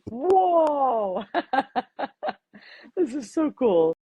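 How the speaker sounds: delighted whoa. Real, not acted. Delighted reaction to surprise.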